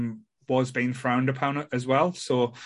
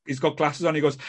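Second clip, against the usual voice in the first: usual speaking voice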